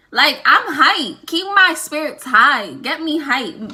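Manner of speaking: sarcastic hyped. Acted. First track is someone crying.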